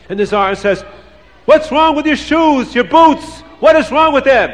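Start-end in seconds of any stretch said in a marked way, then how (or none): 1.4-4.5 very high, loud tirade; screaming. A narrator's reading. Tsar berating a soldier over his boots, screaming. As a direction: yell each question at the cowering soldier. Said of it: "the Tsar is screaming at the soldier".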